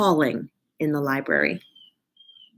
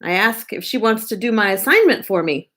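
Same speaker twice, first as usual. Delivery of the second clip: usual speaking voice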